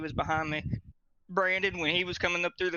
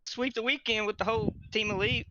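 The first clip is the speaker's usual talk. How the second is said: normal talking voice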